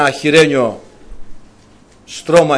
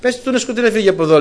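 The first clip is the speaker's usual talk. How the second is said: scornful Naval; 5 semitones higher, 4 dB louder, faster than usual. Retold or imitated. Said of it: "Και λέει ο Νάβαλ".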